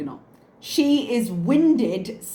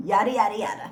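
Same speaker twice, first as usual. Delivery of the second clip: mocking echo; faster than usual. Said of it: "She doesn't even believe herself".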